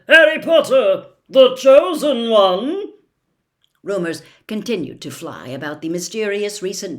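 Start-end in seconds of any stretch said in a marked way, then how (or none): 0.1-2.9 blaring headline. A narrator's reading. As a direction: boom it like a front page banner. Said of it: "The headline of one blared".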